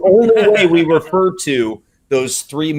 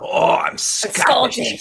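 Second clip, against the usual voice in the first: slow, higher put-on Scottish accent; a drawn-out Scottish Capaldi line; mock Scottish; 7 semitones higher, much slower than usual. Friend quoting the Doctor's joke in slow Scottish. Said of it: "he's like".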